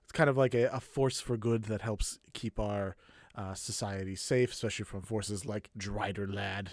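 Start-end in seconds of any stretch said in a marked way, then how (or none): 4.5-6.7 quiet, low disdain for a rival; low-voiced scorn; disdainful. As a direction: let contempt weigh on the name, softly. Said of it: "so much disdain in his voice".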